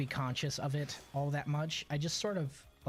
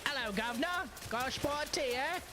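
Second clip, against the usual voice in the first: hammed-up British accent, pitched higher; mock British accent; 7 semitones higher, faster than usual. Explaining going British on greetings, mock British accent. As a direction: play a cartoon Brit asking for tea. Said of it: "what is going British on the greetings".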